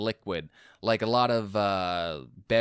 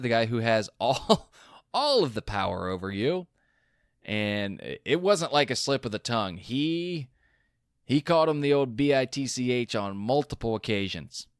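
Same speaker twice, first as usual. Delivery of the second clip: ordinary talk, nothing marked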